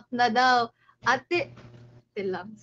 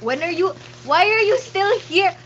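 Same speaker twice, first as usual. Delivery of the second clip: loud, high surprised outburst; startled exclaim; 6 semitones higher, 11 dB louder than usual. Real, not acted. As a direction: jump at someone still being there. Surprised at viewer still here.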